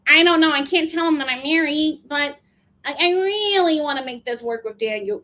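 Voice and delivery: high, put-on whine; imitated anguished confessional. Recapper mimicking a woman hiding her marriage, mock whining. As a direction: mimic her pleading to camera.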